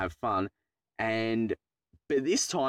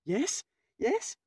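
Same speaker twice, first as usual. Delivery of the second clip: very high, rising hopeful yes; 12 semitones higher than usual. Retold or imitated. Learner driver expecting good news from test lady, hopeful. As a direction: re-enact hope with the pitch shooting up.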